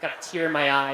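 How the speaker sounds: usual speaking voice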